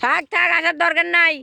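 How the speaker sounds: high-pitched call across a distance. Real, not acted. Telling the friend not to bother coming, calling out. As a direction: call it out, waving them off.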